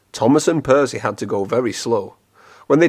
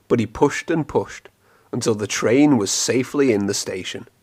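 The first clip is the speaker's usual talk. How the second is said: ordinary delivery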